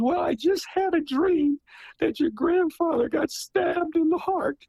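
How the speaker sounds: high worried mother telling her son; dramatized mother. Retold or imitated. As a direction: voice the frightened mother describing her dream. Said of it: "pardon my, uh, characterization".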